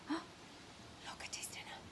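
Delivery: high breathy whisper, slow. Acted. Storyteller whispering to children about the dinner, hushed. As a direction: hush it like a secret.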